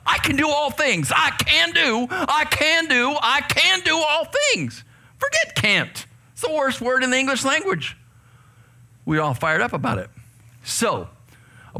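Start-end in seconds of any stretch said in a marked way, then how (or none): 0.1-4.8 fired up. Retold or imitated. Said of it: "we got fired up". 5.2-8.0 fired-up preaching. Acted. Preacher to youth, fired up and high.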